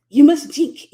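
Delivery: a German host pressing a drink on you; German accent. Retold or imitated. German host insisting she drink, mock German accent. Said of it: "I'm trying to remember the German accent".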